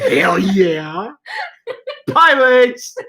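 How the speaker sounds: cartoon voice reading the comments, laughing; silly cartoon character reading aloud, breaking into laughs; Homestar Runner voice. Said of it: "I'll do it with the comments right now".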